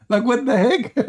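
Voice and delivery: high-pitched, amused incredulity; laughing disbelief. Real, not acted. Host baffled by the exit, laughing. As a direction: squeeze the question out between laughs.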